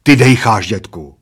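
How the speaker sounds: accusing, loud start then dropping; bitter amazement. A narrator's reading. Bald man accuses friend of breathing, bitter amazement. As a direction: accuse him in aggrieved disbelief. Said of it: "s jistou směsicí roztrpčení a údivu zároveň".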